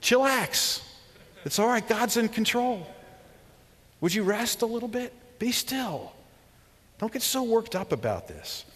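high, laid-back buddy voice; mock modern slang, relaxed; preacher paraphrasing God as a chill friend; casual paraphrase. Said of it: "the modern translation of".

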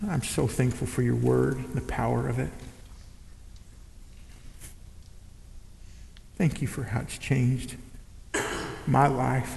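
soft, low, earnest prayer; closing prayer. Acted. Pastor praying softly over the church. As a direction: pray it quietly over the congregation.

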